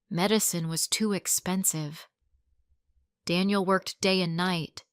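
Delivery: usual speaking voice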